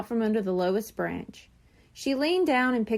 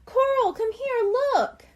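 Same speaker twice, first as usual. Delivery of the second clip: much higher calling out; excited yell; 12 semitones higher than usual. A narrator's reading. Shrimp yells for his sister to come look, high and excited. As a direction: call your sister over from afar. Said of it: "heard Shrimp yelling".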